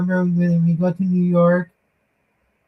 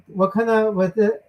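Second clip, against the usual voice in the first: ordinary talk, nothing marked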